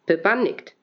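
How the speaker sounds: plain reading voice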